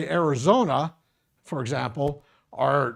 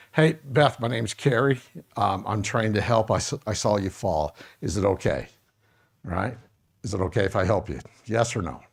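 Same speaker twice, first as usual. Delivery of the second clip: lower, gentle rescuer voice; calm helper demo; 6 semitones lower than usual. Shown as a demonstration. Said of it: "We're supposed to help the best we can".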